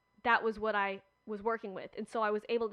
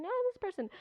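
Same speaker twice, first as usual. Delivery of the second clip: "No, this person" pushed much higher, peak of the squabble; imitated squabble, pitched up; arguing even higher; 11 semitones higher, much faster than usual. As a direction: top the last voice, climbing higher still. Upset cast members arguing.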